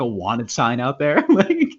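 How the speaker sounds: ordinary talk, nothing marked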